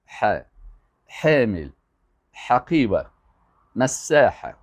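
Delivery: ح made in the throat in "حامل", "حقیبه", "مساحه", word by word; throaty Arabic ح. Shown as a demonstration. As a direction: push a throaty h for ح at the start of "حامل". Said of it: "باید از حلق تلفظ کنی".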